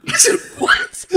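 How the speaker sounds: high laughing 'What?'; laughing disbelief. Real, not acted. Storyteller hears the shoe-throwing story, laughing disbelief.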